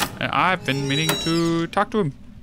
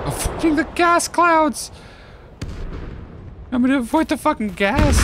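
shout; 11 semitones higher than usual. Real, not acted. Dodging gas clouds, yelling. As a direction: yell it while running.

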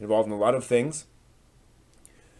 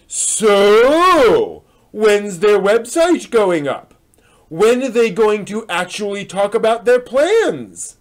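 lively ranting reading voice, a bit higher and louder; animated post reading; 10 semitones higher, 11 dB louder, slower than usual. Acted. Reading a fan's forum complaint aloud, indignant. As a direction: read the rant like an indignant fan.

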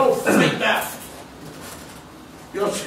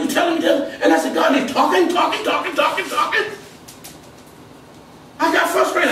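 raised, driving preacher's cry; rising sermon shout; fiery preaching; 6 semitones higher, 4 dB louder than usual. Acted. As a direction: preach it hard at the congregation. Preaching to the church.

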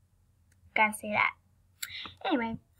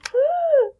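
loud excited gasp; 12 dB louder than usual. Real, not acted. New pet revealed.

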